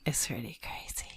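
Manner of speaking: hushed whisper. Real, not acted. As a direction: breathe it out as a secret. Hushed aside about ghost story.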